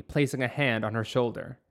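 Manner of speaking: ordinary delivery, nothing marked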